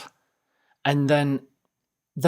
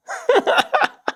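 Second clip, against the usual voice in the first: high squealing laugh; high burst of laughter; 5 dB louder than usual. Real, not acted. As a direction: let out a shrill delighted laugh. Laughing at own punchline.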